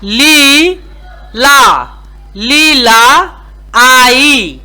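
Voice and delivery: "ली", "ला", then "लीला आई", each part held and separated; syllable-by-syllable reading. Shown as a demonstration. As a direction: spell out "लीला" in two long syllables, then join them. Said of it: "अगर आप पढ़ते हैं".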